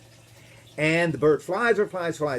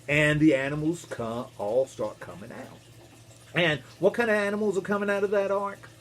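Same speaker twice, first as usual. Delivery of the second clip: ordinary delivery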